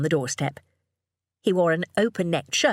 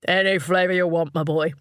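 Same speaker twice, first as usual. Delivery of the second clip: indulgent father; 4 dB louder than usual. A narrator's reading. Father indulging his excited son.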